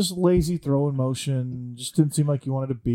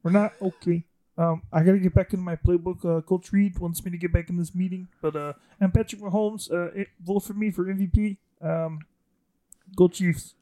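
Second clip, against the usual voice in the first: pitched-up, put-on young quarterback voice; Patrick Mahomes voice; 5 semitones higher than usual. Quarterback caller crashing the show, higher put-on voice. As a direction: play the star quarterback dropping in, raised voice. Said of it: "uh, Patrick".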